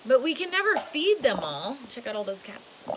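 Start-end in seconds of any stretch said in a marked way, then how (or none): 0.1-1.8 lively storybook read, rising and falling; storybook voice for a little one; reading to children. A narrator's reading. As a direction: read it big and animated for a child.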